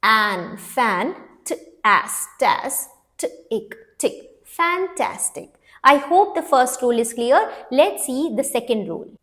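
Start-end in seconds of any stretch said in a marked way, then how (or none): 0.0-5.5 "fan", "tas", "tick" built sound by sound, then "Fantastic". Shown as a demonstration. Teacher reads a split word for children, sounding out "Fantastic". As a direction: blend each syllable separately, pausing, before the whole word "Fantastic". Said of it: "Now let's read it".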